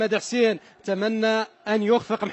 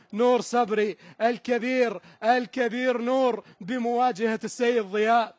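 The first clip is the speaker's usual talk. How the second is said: normal talking voice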